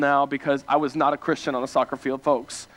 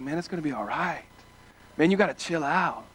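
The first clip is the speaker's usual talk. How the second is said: mock calm reassurance; 3 semitones lower, 5 dB quieter than usual. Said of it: "she's just like".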